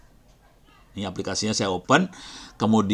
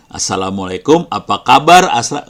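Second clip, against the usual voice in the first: reading it out; 9 dB louder than usual. Shown as a demonstration. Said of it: "saya bikin tulisannya gitu".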